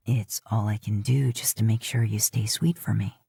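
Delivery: low, playful tease; a hint of teasing. A narrator's reading. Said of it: "a hint of teasing returned to his voice".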